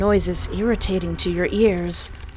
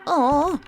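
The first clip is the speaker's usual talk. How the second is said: grossed-out groan; 8 semitones higher than usual. Real, not acted. Splat of the creature.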